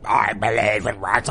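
low, slow blob groaning out words; blob creature voice. Acted. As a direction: drag it out like a face trapped in a blob. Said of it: "a flat blob face going".

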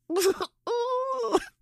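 real laugh breaking out high; high burst of laughter. Real, not acted. Laughs at friend's hemoglobin level.

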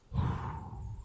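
long steadying exhale; deep breath. Acted. Haggard man composing himself, deep breath. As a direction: let a deep breath out slowly. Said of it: "takes in a deep breath".

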